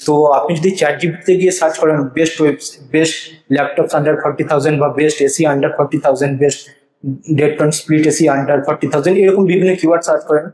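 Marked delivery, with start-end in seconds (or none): 2.2-9.1 slow dictation. Shown as a demonstration. Reading example search queries.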